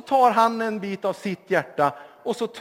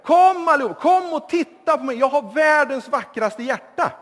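loud, high boastful shout, louder still; young man, flattered, shouts louder to the crowd; shouting even louder; 8 semitones higher, 8 dB louder than usual. Acted. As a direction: crow it to the whole square, even louder. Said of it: "Då ropar vi ännu högre".

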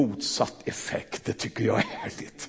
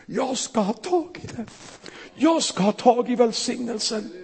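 high, intense voice of the desperate beggar; beggar's vow; 9 semitones higher than usual. Acted. The blind beggar resolving to reach Jesus, pitched high and urgent. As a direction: grab for the blessing, voice pitched up.

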